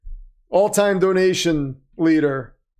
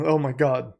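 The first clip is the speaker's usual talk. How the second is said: ordinary delivery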